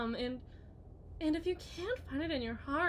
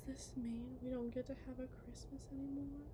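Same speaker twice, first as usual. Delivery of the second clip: breathy hushed whisper, barely voiced; 8 dB quieter, faster than usual. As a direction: lean in and whisper it like a wounded child. Wife taunting husband, whispered opening.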